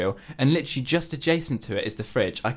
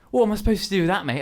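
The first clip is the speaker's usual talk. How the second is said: higher, mildly put-out mock complaint; mock annoyed; 8 semitones higher, faster than usual. Coworker complaining about his milky coffee, in a mock-annoyed voice. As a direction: imitate a coworker's irritated grumble, lightly. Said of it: "he's actually sounding quite annoyed".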